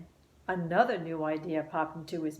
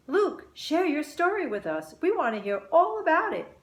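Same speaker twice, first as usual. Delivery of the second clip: eager chorus; 10 semitones higher, 4 dB louder than usual. A narrator's reading. Family speaking together.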